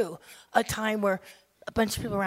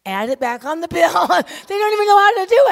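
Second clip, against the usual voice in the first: laughing exasperation; 9 semitones higher, 12 dB louder than usual. Acted. Mock exasperation over restaurant bill.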